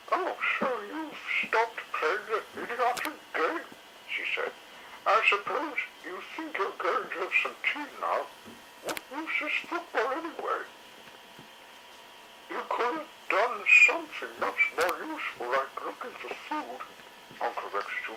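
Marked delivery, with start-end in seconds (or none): 0.1-8.3 much higher, put-on matron voice; high sniping lady dinosaur; sulky Missus Carey. A narrator's reading. 8.8-10.6 much higher, put-on scornful matron voice; high lady dinosaur mocking the game; scornful Missus Carey. A narrator's reading. 12.5-16.8 much higher, put-on matron voice; high sniping lady dinosaur; sulky Missus Carey. A narrator's reading.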